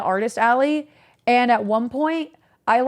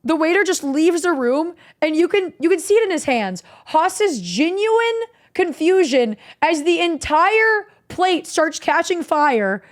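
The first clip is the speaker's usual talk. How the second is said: normal talking voice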